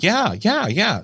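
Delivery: quick high eager yeahs; eager crowd. Retold or imitated. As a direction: mimic the crowd jumping at the offer. Eager crowd answers commander.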